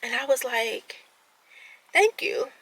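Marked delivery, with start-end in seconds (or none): 1.9-2.5 high, lilting coy voice. Retold or imitated. Accepting a compliment from new friends without sounding cocky, coy. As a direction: play it coy, pitched well up. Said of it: "I was really trying to be coy".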